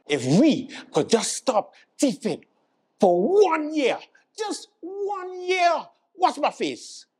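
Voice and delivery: slow, very high squeal; hammed-up, drawn-out high begging; mock pleading. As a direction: stretch the plea up into falsetto. Acted politician pleading against thieving.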